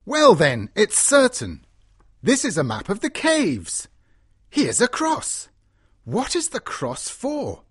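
high-pitched, wondering character voice; excited deduction. A narrator's reading. Lord reading the map of the caves, excited. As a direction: puzzle it out eagerly, piece by piece.

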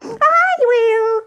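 very high, excited cat cry; eager cry. A narrator's reading. Cat scampering to the loaf, crying out eagerly. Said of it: "cried the Cat".

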